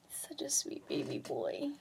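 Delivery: soft, low whisper to a pet. Real, not acted. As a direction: breathe it to the puppy. Whispering to the puppy.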